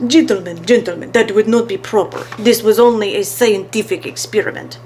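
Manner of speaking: smooth, foreign-sounding showman; suave professor. A narrator's reading. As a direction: purr it like a stage magician.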